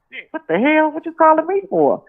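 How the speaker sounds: high-pitched, giddy retold disbelief; geeked, hiding it. Retelling her reaction to [name]'s call, secretly geeked. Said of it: "I'm trying not to let him know".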